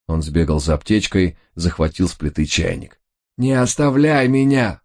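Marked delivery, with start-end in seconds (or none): 3.4-4.8 howling. A narrator's reading. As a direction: wail not to be left. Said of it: "взвыл Рой". (воет от страха)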